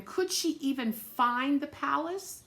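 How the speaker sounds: ordinary delivery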